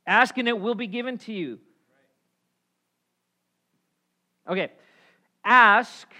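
loud, high-pitched sermon delivery; full-force preaching. Real, not acted. Preacher urging the congregation to ask God, preaching at full force. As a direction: preach it out loud with big emphatic stresses.

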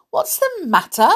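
loud, high indignant echo. A narrator's reading. Worried mouse repeating the doctor's question to him, indignant. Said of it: "Olivia repeated indignantly".

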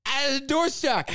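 high, slow mock innocence; fake innocent excuse. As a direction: play dumb, slow and high. Voicing boss excuse.

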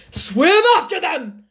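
high, loud yell; excited scream. A narrator's reading. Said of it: "screamed the Gryphon".

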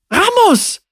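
high joyful shout; calling out. A narrator's reading. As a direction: call the name out across the sand. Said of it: "rief Ojan".

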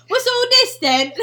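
high, loud mock British accent; laughing, badly faked British accent. Echoing the co-host's tweet, mock British accent. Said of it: "British people be like".

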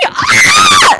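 very high, loud mock fangirl squeal; excited squeal. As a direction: squeal hammed-up over a crush. Squeal ending thrilled crush reaction.

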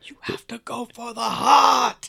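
high, loud, breathy movie-line yell; mock vampire hunter shout. Friend quoting Dracula Dead and Loving It, mock shout.

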